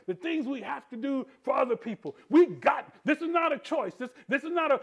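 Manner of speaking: usual speaking voice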